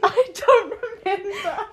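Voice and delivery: high-pitched, laughing outburst; laughing admission. Real, not acted. Co-host pressed about a fake accent, laughing high. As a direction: start loud and high, laughing at yourself.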